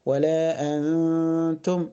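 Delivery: nun of أنتم hidden in a long nasal hum; ikhfa. Shown as a demonstration. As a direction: hold a slow hum before the ت of أنتم. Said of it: "لا أظهر النون في النطق". (إخفاء نون أنتم بغنّة طويلة)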